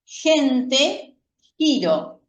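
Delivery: "gente" y "giro" con la g sonando como jota. Shown as a demonstration. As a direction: raspar la g de "gente" como una j. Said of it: "como si fuera la J del español". (g de "gente" como jota española)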